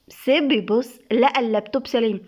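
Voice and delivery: ordinary delivery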